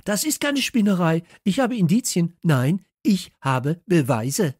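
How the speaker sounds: high, loud, indignant outburst; outraged high-pitched shouting; shrieking protest. A narrator's reading. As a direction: screech your defence, emphatic to the end. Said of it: "kreischte Kalle".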